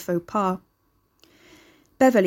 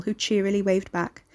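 ordinary delivery

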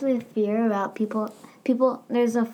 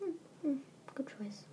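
whisper; 4 semitones higher, 20 dB quieter, slower than usual. Real, not acted. Whispered approval.

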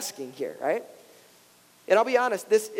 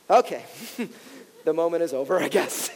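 laughing resignation; 4 semitones lower than usual. Retold or imitated. Parent's deep talk ruined by kids.

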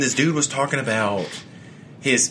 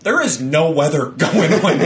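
laughing through the words; slower than usual. Retold or imitated. Retelling his own reply to the neighbor, laughing. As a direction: chuckle out the line in disbelief.

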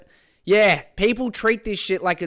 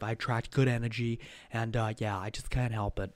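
muttered aside; 8 semitones lower, 10 dB quieter than usual. Real, not acted. Muttering aside about messages.

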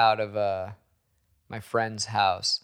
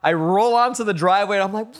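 ordinary talk, nothing marked

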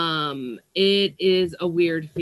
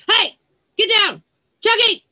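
loud, high scolding shout; scolding the cat; 13 semitones higher, 8 dB louder than usual. Real, not acted. Owner shouting at her cat to get off the plant, sharp and loud.

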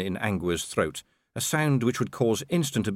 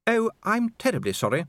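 lightly voiced Lady Sybil, much higher; a polite reflex apology, pitched up; automatic apology; 11 semitones higher than usual. A narrator's reading. Lady Sybil apologises, automatic and high-pitched. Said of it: "said Lady Sybil automatically".

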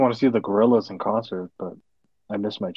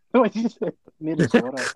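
high-pitched, laughing question; cracking up while asking; 11 semitones higher than usual. Real, not acted. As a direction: ask it through real laughter. Cracking up at the pronunciation.

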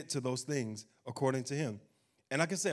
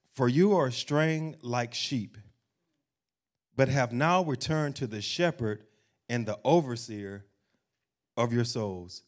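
slow, deliberate, slightly louder reading; solemn scripture reading; 3 semitones lower, 5 dB louder, slower than usual. Acted. Preacher reads Psalm twenty-three to the congregation, slow and solemn. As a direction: weigh each line of the psalm. Reads First Peter aloud.

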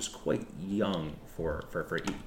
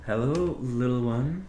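sweet greeting; 4 semitones higher, 5 dB louder than usual. Acted. Nun greets the bird.

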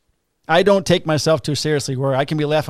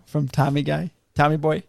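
normal talking voice

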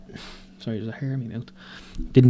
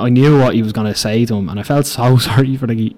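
normal talking voice

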